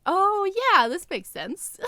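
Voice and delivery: sudden mock discovery, high and loud; mock realization. Reacting to edited version.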